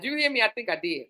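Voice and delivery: usual speaking voice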